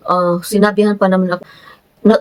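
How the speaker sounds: ordinary delivery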